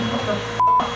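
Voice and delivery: shocked high cry; panicking gamer. Real, not acted. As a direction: caught off guard, disbelieving. Gamer's console suddenly shuts off.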